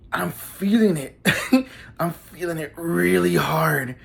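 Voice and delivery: slow, dreamy, giggling in between; loopy and drugged. Retold or imitated. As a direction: savour the buzz like you're floating. Drug kicking in, giggly.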